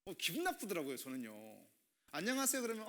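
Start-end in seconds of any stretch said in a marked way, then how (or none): 2.1-2.6 higher, bright hello; cheery greeting. Shown as a demonstration. Pastor greets a young member with a cheerful hello. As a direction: call out a cheerful hi. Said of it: "이렇게 인사해야 되는 게 맞는".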